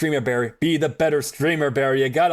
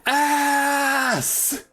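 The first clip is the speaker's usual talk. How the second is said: a high, pitched-up mock yell; 9 semitones higher than usual. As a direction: shout it out to the world for fun. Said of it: "Yell it to the world".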